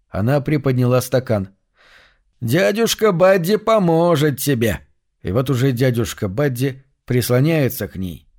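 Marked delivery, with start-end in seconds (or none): 2.4-4.8 slow, higher coaxing voice; coaxing uncle. A narrator's reading. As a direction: coax her gently and slowly. Uncle Buddy coaxing girl.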